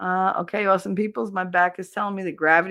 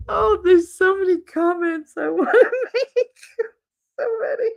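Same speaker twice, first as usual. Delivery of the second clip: laughing; 5 dB louder than usual. Real, not acted. Reacting to a viewer's comment, laughing high and loud. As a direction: giggle out the words, barely holding it in. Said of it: "laughs reading a viewer comment".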